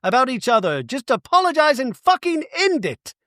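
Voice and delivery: half-hammed friend pleading for peace; imitating Bronwyn. Bronwyn begging both friends to make up, mock-earnest. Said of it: "Bronwyn goes".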